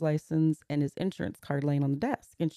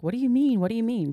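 imitating the daughter's panicked questions, higher and louder; a mock panicky daughter, pitched up; 7 semitones higher, 4 dB louder than usual. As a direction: replay her frantic, high-pitched repeated question. Daughter hears father collapsed.

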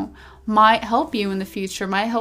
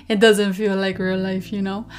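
normal talking voice

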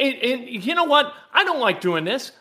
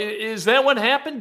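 ordinary talk, nothing marked

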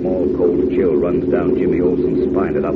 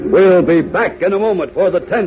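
higher, louder serial announcer; radio announcer; 11 semitones higher, 4 dB louder than usual. Acted. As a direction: hype the coming climax. Announcer teases the cliffhanger.

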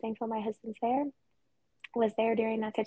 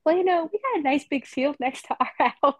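put-on higher, louder kid voice, laughing at the end; mock kid voice; 5 semitones higher, 7 dB louder than usual. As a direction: kids teasing about the big field next door, in a mock kid voice. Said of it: "they were like".

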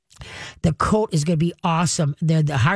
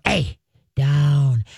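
growl; 4 semitones lower than usual. Shown as a demonstration. Trainer showing owners a low growled down command. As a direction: bark the word down low. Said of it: "Give 'em a growl".

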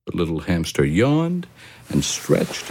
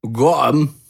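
louder, higher call; Mark calling back; 9 semitones higher, 6 dB louder than usual. A narrator's reading. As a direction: shout it back from the wardrobe. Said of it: "cried Mark".

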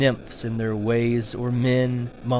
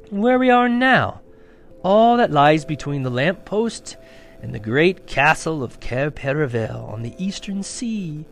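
higher and louder, sweeping proud faun; grand fawn; 3 semitones higher, 6 dB louder than usual. A narrator's reading. The fawn proudly tells Lucy about Narnia, higher and louder. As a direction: show off the land proudly.

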